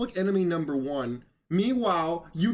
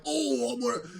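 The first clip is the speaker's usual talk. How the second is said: higher-pitched, hammed-up tough act; mock tough guy; 6 semitones higher than usual. Host mocking a radio rival's big tough guy act, mock tough. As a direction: send up a blustering tough guy. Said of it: "big tough guy act".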